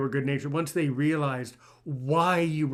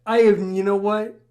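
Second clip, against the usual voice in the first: excited; 5 semitones higher, 7 dB louder than usual. Real, not acted. Host reacts excitedly to story.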